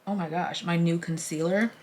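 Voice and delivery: ordinary talk, nothing marked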